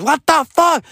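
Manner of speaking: high-pitched indignant woman's yell. Retold or imitated. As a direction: imitate an angry woman yelling from a car. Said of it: "basically what she says".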